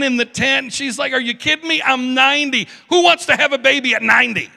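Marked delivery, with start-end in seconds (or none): 1.1-4.5 high, loud comic disbelief; hammed-up outraged astonishment; incredulous Sarah. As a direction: scoff in a raised, high-pitched voice. Sarah scoffing at having a baby.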